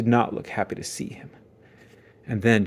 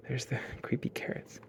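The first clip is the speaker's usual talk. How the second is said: soft unvoiced aside; whisper; 17 dB quieter than usual. Real, not acted. Narrator sneaking a hushed joke to listeners.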